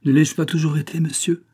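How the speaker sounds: wistful, sighing question; sighing reply. A narrator's reading. Andrée answering the count with a sigh. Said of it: "répondit André avec un soupir".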